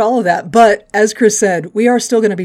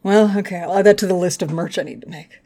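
usual speaking voice

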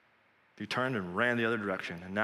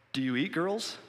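mildly fearful, higher-pitched question; 6 semitones higher than usual. A narrator's reading. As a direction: ask it timidly, voice lifting. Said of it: "she asked fearfully".

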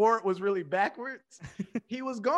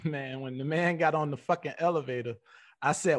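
usual speaking voice

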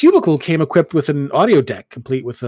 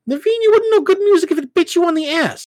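high, loud playful taunt; teasing young woman; 5 dB louder than usual. Acted. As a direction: tease him hard, pitched way up. Ellen teasing Naveen.

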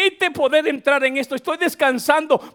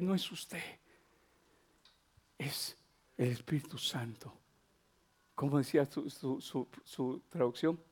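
very low, barely voiced murmur; hushed, moved; 11 semitones lower, 15 dB quieter than usual. Real, not acted. Preacher recalling last night's tearful thanks, hushed. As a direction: sink into near-silent, halting reverence. Recalls weeping prayer, nearly whispering.

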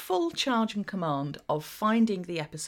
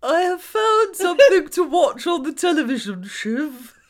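higher, louder put-on voice of her co-host; mock Kathy impression; 5 semitones higher, 9 dB louder than usual. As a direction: lift the voice and ham up her friend's line. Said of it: "do an impression of my voice".

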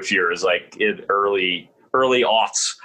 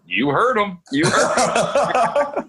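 laughing retort; 10 semitones higher, much slower than usual. Retold or imitated. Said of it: "And I'm like".

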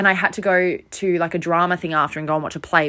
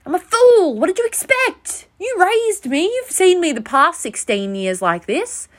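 much higher, whiny self-mocking plea; flustered squeaky protest; 10 semitones higher, slower than usual. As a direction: squeak it out like a guilty kid defending herself. Flustered reply to angry dad.

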